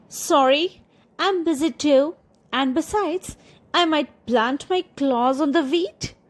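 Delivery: lightly voiced fussy cat; a prissy cat turning the hen down, high; high lazy cat. Hen asks the lazy cat for help, lightly voiced high cat.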